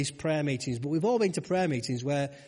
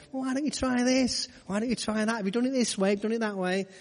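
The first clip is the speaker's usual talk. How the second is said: higher, chirpy, mock-helpful suggestions; helpful know-it-all voice; 5 semitones higher than usual. Preacher mimicking a know-it-all offering fishermen advice, mock-helpful. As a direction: play the smug helper offering tips. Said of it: "with that helpful voice".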